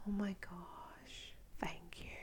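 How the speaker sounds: slow, very soft whispered thanks; hushed gratitude. Acted. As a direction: whisper it slowly, as if overcome. Acts out joy at new followers.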